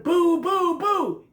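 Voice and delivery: very high, loud scary shout. A narrator's reading. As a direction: blast the boos out to scare. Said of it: "He shouted".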